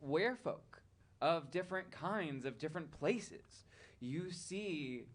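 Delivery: ordinary talk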